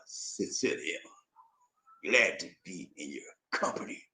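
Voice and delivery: very low, hushed, whispery courtroom-star voice; Perry Mason. Acted. Host competing at impressions, low whispery suave voice. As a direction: drop deep and nearly whisper, with long pauses. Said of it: "get my Perry Mason voice".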